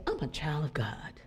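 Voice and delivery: breathy whispered affirmation; hushed, intimate declaration of faith. Acted. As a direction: whisper it like a secret truth.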